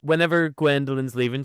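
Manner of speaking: ordinary talk, nothing marked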